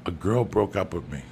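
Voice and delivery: normal talking voice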